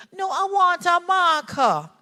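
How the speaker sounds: put-on high, slow, insistent small child; little boy's voice. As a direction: voice the demanding three-year-old. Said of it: "the little boy was three".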